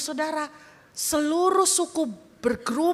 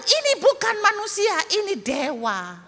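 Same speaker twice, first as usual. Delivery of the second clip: frightened cry; 8 semitones higher than usual. Acted. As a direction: shout in fear that he is no man. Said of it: "mereka jadi ketakutan".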